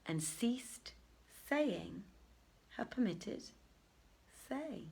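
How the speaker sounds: slow, hushed closing of the night's tale; quiet storyteller's sign-off; soft closing formula. A narrator's reading. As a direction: let the ending fade softly away.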